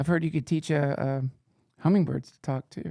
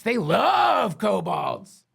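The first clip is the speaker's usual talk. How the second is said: sarcastic; 4 semitones higher, much slower than usual. Pretend. Sarcastic rant about Vrindax.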